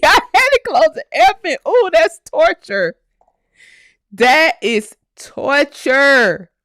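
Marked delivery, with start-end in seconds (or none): 1.7-6.5 amused exclamation. Real, not acted. Amused sympathy.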